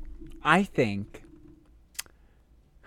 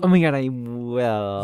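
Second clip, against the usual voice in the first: mock excited yes; 5 dB louder, slower than usual. Mock acceptance.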